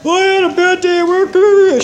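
high, loud mock whine, far from her own voice; whiny complaining. Mocking her old self whining to Tony West, in a high loud whine. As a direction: whine like a sulking kid about work. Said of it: "I'd be like".

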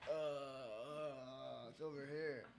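put-on low, soft drunken fumbling; drunk mumble. Drunk speaker who can't give his address, fumbling quietly. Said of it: "All I could do was like".

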